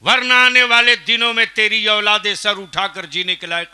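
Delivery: loud, high warning shout; thundering warning. Real, not acted. Preacher warning villagers about their children's future, loud and stern.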